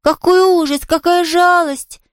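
put-on high child's cry of sympathy; sincerely distressed girl. A narrator's reading. Girl hearing of the invalid's sleepless night, distressed. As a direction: pity her in a high girlish voice. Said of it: "Искренне огорчившись, вздохнула Полианна".

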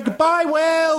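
slow, high, stretched farewell; long drawn-out goodbye. Acted. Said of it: "a big way long goodbye".

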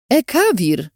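high tearful shout of the name; overjoyed reunion shout; joyful cry. Acted. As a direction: cry out on seeing a lost friend. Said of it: "krzyknęłam".

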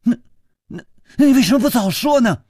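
stammering, fast and high-pitched; flustered, rapid-fire astonishment; wide-eyed, stunned. A narrator's reading. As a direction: blurt it out in a high, loud rush of disbelief. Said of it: "花金公眼睛发直".

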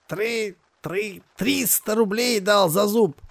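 high gurgling stammer; gurgling hunchback. Acted. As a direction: gurgle it out like a brute. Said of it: "пробулькал Архип".

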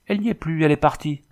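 high, startled cry of dismay; high-pitched astonishment; shocked discovery. A narrator's reading. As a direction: announce it in alarm, pitch jumping up. Woman finds the captive gone.

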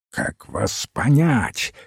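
plain reading voice

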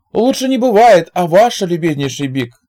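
very high, beaming reply; high forced cheer. A narrator's reading. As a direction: overdo the happiness. Zig answers cheerfully, high.

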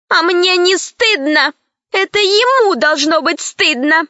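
put-on high defiant girl retort. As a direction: talk back like a girl. Lara retorting.

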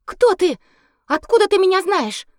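excited cry. Acted. Girl cries out to stranger.

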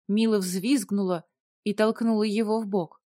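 ordinary delivery

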